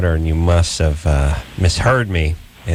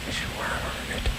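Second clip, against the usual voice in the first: hushed whisper; 14 dB quieter than usual. Real, not acted. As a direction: whisper while listening for a sound. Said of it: "Let's be quiet and see".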